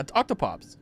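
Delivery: ordinary delivery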